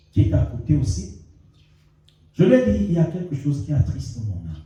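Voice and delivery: ordinary talk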